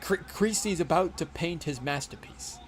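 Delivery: slow, measured, quirky actor voice; a light Walken movie-villain take; Christopher Walken impression. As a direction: quote the movie line in an eerie, deliberate cadence. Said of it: "We need to watch Man on Fire".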